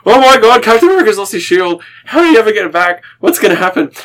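breathless-with-suspense mock dismay; retold alarm. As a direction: relive a reader's panic at a cliffhanger. Retelling childhood reaction to a cliffhanger.

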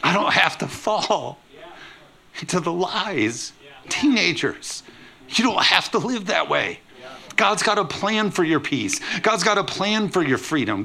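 put-on rally the teenagers with fervor; full-force preaching.

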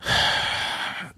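long, soft breathy sigh; exasperated sigh. Acted. Co-host finds him easily fooled, exasperated sigh. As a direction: let out a weary sigh.

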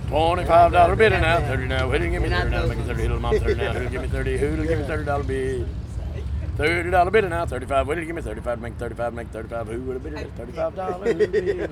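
rapid auction chant; auctioneer. Acted. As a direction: rattle off bids like an auctioneer. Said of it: "gotta learn that auction cry".

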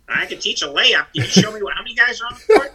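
high-pitched, self-mocking plea of confusion; clueless coach. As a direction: play up your own cluelessness, voice climbing high. Mocking his own cluelessness as coach.

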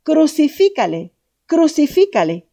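high, loud mob yell; crowd shouting. Acted. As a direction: scream it like an angry crowd demanding death.

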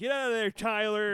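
put-on loud scolding shout at a kid; yelling dad. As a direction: bark it at a misbehaving son. Acting dad yelling at feral son.

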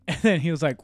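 ordinary talk, nothing marked

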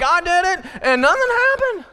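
high, smug whiny quote; high mock voice of someone unpunished; mocking the unrepentant. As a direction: mimic a smug rule-breaker. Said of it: "and they're like".

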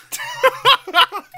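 high, loud burst of laughter. Real, not acted. Laughing at the story.

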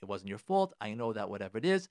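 low, soft, conciliatory demo line; softly spoken reassuring opening to a spouse; calm gentle opener. Shown as a demonstration. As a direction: model how to raise it gently with your spouse. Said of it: "you start off defending".

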